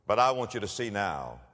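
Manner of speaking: normal talking voice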